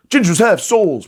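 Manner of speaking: a hammed-up outburst; mock ranting kid. Comedian recalls the viral redhead kid, mock ranting plea. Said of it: "You had the psycho redhead kid".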